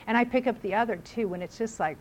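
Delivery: ordinary talk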